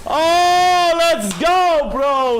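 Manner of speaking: loud high hype shout; yelling in triumph after a big play; gym bro. Real, not acted. Team fight just won.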